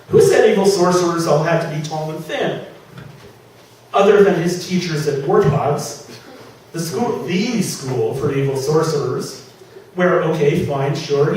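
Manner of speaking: ordinary delivery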